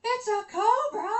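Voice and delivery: high disbelieving echo; mock startled neighbor; startled question. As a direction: neighbor shocked at the news.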